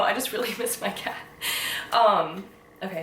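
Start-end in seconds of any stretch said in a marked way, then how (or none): 0.0-1.2 tearful laugh. Real, not acted. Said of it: "why am I actually gonna cry".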